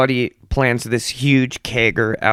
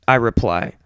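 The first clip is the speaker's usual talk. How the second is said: normal talking voice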